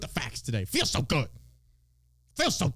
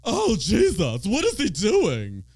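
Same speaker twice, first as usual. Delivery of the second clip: higher, louder, slow scandalized gasping; mock pearl clutcher; 4 semitones higher, 4 dB louder, slower than usual. Mocking shocked voters who clutch pearls, hammed-up horror. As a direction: ham up a shocked churchgoer. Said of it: "to clutch your pearls".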